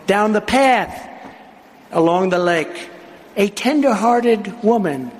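dramatic poem reading with pauses; poem recitation. Acted. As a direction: recite it like a storyteller at a rally.

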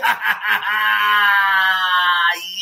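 big laugh that breaks into a shout. Real, not acted. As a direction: burst out laughing. Laughs after announcer bit.

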